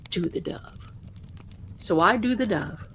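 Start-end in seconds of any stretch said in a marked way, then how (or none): 0.1-0.9 whispered, egging her on; hushed grandpa. Retold or imitated. Grandpa eggs her on to do the call again, whispered. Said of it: "he looks at me and goes".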